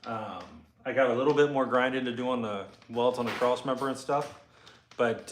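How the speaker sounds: normal talking voice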